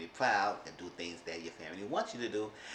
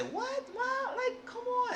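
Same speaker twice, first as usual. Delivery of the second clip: high falsetto whine as Wendy; squeaky pleading grown daughter; imitating Wendy pleading her mom; mock whiny daughter; much slower than usual. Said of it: "The mom, Wendy would say".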